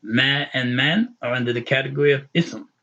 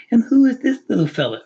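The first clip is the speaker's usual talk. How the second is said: put-on high, cooing baby talk to a pet; mock pet-talk voice, much higher and louder; doting dog owner; 10 semitones higher, 5 dB louder than usual. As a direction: coo at a little dog like a proud owner. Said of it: "there are some people that will say".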